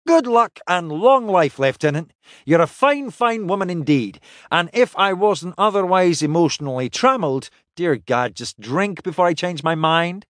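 hearty toasting crewman. Acted. Toasting the lieutenant before downing a drink, hearty. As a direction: lift the pitch and toast with gusto.

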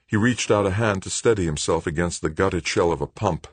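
usual speaking voice

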